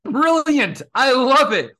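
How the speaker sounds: overjoyed fan squeal; gushing delight. Retold or imitated. Fan reacting to the writers' fix: high, loud, delighted. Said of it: "And I'm like".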